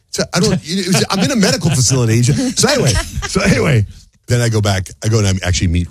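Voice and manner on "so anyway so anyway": usual speaking voice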